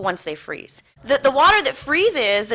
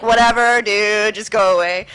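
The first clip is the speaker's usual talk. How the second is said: louder, weary brush-off; mock dismissive; 6 dB louder than usual. Speaker sending the party guy away, loud dismissal. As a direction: shoo away a pest.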